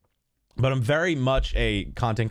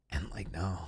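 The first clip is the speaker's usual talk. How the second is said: soft whispered no; 6 semitones lower, 12 dB quieter than usual. Real, not acted. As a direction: breathe it as a whisper. Denying being angry, whispered.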